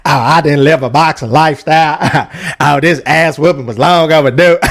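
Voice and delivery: low voice with put-on chuckles; mock the boxer, chuckling through his excuses; mocking excuse-maker. Quoting a beaten boxer's excuses, low mocking imitation. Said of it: "the nerve, the unmitigated gall".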